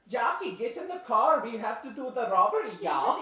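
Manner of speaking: loud, high exaggerated Boston accent. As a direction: bark orders in a thick Boston accent. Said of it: "That's how she talks".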